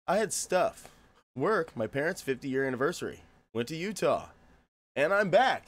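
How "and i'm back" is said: loud, raised impression of another man; imitating someone's catchphrase. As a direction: announce it loudly as his catchphrase. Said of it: "That's exactly how he sounds".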